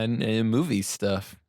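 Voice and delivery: ordinary talk, nothing marked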